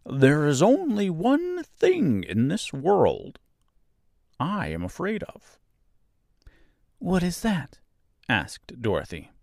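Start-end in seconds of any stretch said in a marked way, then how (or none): 0.1-5.6 confiding scarecrow. A narrator's reading. Scarecrow confides his secret. 7.0-7.8 high, light question; innocently curious Dorothy; curious little girl. A narrator's reading. Dorothy asks about secret.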